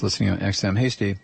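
normal talking voice

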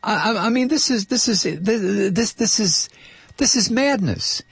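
high, strained exasperation; outraged disbelief. Real, not acted. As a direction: sputter it out in disbelief. Host exploding in outrage.

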